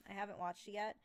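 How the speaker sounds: ordinary delivery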